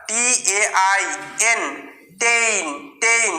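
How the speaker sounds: letters first, then blend into টেইন; teacher sounding out টেইন; slow spelling then sound. Shown as a demonstration. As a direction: spell টিএআইএন slowly, then say টেইন twice, drawn out. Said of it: "এআই এর উচ্চারণটা কিরকম হয়?".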